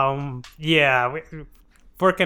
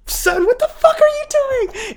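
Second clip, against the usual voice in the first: high, loud mock shriek; hammed-up mother yelling at her son, high and loud; mock outraged mother; 4 dB louder than usual. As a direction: shriek it up high like an appalled mom.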